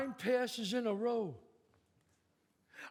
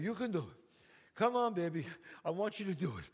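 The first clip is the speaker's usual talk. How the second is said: ordinary delivery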